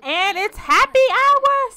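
high, loud and drawn out, thrilled; excited. Real, not acted. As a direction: announce it like the party just started. Arriving at the bar excited.

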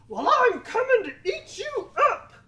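high, very loud troll threat. A narrator's reading. Troll threatens biggest goat.

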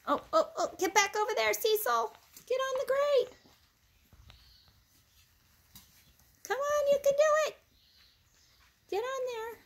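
high excited pet talk; urging the puppy. Real, not acted. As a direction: coax the puppy back onto the grate. Urging puppy onto the grate.

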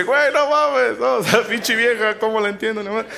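high-pitched, acted exasperation; exasperated venting. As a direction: vent like a baffled husband. Hombre desesperado por su mujer. (desahogo exasperado, actuado y agudo)

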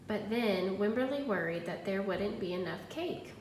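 ordinary delivery, nothing marked

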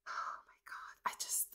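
whispered excitement; excited whisper. Real, not acted. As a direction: whisper it like a thrilled secret. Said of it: "I'm so excited".

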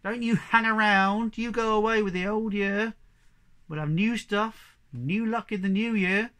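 high, loud chasing-off call; farmer scolding bad spirits. Farmer banging pans at spirits in the orchard, loud scolding.

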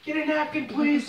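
high, loud, urgent plea for help; desperate high plea. Real, not acted. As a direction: beg for it, mouth on fire. Burning mouth, pleading louder.

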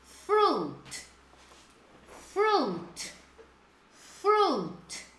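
FRUIT repeated as "froot", the I left out; silent I. Shown as a demonstration. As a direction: model FRUIT with one pure "oo" vowel. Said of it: "Não pronuncia o I".

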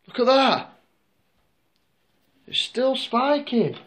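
high, excited and urgent. Real, not acted. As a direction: point it out, thrilled and amazed. Meter spiking, excited reaction.

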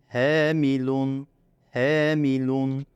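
Hemilun with the he held long; teacher drawing out Hemilun; lengthened. Shown as a demonstration. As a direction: stretch Hemilun very slowly. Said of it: "diyerek uzatılıyor".

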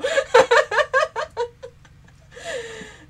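loud, high burst of laughter. Real, not acted. Telling viewers about her dad, cracking up laughing. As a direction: crack up at the memory.

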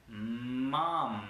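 "mom" with both Ms hummed long; stretched, slow "mom", lingering on the consonants; long vibrating M. Shown as a demonstration. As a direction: make the Ms in "mom" buzz and tickle. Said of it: "mucho más tiempo la consonante".

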